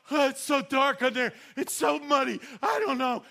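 hammed-up despair for the watching crowd; playing it up. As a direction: overplay the struggle to fool them. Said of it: "if I play this right".

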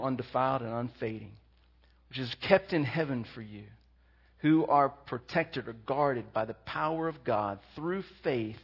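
soft, hushed scripture reading. Acted. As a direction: read the verses quietly and reverently. Reads scripture aloud, hushed.

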